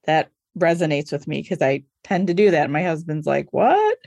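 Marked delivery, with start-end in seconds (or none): 3.5-4.0 weary husband. Retold or imitated. Husband's weary reaction, voiced.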